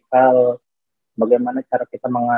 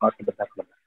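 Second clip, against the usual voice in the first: bad connection; 13 semitones higher, 8 dB quieter than usual. Real, not acted. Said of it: "Suaranya putus-putus".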